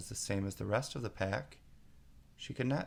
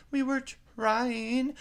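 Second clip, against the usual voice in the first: much higher, slow, timid pleading; very humble; 6 dB louder, much slower than usual. A narrator's reading. Gardener pleads humbly on one knee before the queen. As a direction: kneel and grovel. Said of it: "in a very humble tone".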